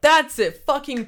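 high, loud yell of recognition; blurting out delight at the answer; excited yell. Real, not acted. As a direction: shout it, thrilled he got it.

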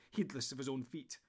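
plain reading voice

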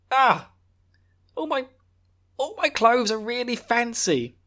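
high, slow whining about fancy clothes; whiny embarrassed. Retold or imitated. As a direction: whine it, embarrassed.

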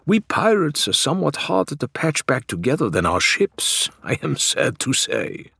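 a hint of a rueful sailor; lightly voiced Faisal. A narrator's reading.

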